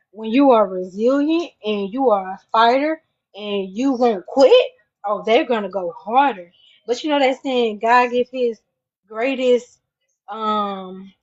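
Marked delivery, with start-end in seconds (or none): none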